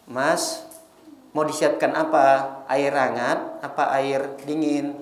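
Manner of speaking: wife greeting her husband gently after work; tender wife. Retold or imitated. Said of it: "Harusnya kan begitu".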